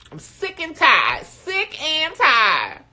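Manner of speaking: fed up, belting the complaint; loud complaint. Retold or imitated. Venting to the group about the wet spot, loud and fed up.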